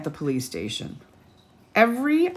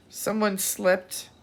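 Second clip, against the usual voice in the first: plain reading voice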